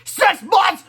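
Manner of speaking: very high, loud outraged scream; high screamed question. Acted. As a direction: scream in disbelief. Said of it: "screamed Crappy the Kid".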